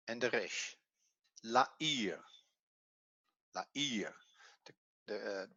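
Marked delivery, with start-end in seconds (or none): 1.4-2.2 La'ir with the weight on the final "ir"; stress on last syllable. Shown as a demonstration. Teacher reading out the transliterated word, stress on last syllable. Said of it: "always on the last, uh, syllable". 3.6-4.1 La'ir said softly, weight on "ir"; softer La'ir, still stressing the final "ir"; stress on last syllable. Shown as a demonstration. Teacher repeating the word for learners, stress on last syllable. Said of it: "always on the last, uh, syllable".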